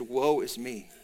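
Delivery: low, hushed retelling of dread; imitated fright. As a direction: drop the voice for a subdued, frightened quote. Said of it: "He's frightened".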